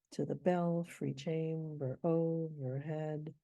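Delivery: slow, beat by beat; scanning the meter. Shown as a demonstration. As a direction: mark each foot. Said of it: "These are all two-syllable feet".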